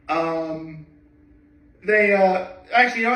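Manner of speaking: normal talking voice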